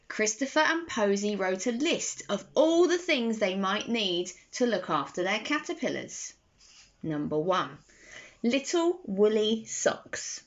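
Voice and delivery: usual speaking voice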